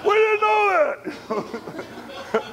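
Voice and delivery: high, loud, drawn-out laughing disbelief; amazed exclamation. Retold or imitated. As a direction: stretch it out in laughing shock. Guy shocked, laughing retelling.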